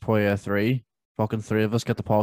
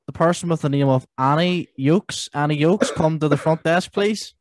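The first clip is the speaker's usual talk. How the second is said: higher, slow tannoy voice; supermarket announcement; 4 semitones higher, 5 dB louder, slower than usual. Pretend. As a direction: page a customer, drawn out and high. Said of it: "the Tannoy in Tesco's".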